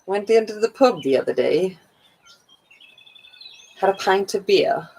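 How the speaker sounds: lightly put-on British accent; hammy attempt at sounding British. Shown as a demonstration. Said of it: "I'll put on a British accent".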